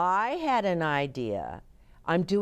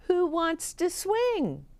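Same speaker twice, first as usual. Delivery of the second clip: high, excited offer; delighted invitation; 12 semitones higher than usual. A narrator's reading. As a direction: ring it out high and happily. Delighted Ruby offers the swing.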